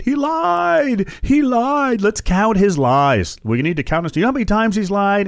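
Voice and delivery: hair-on-fire ranting caricature; mock hysterical critic. Mocking hysterical Trump haters.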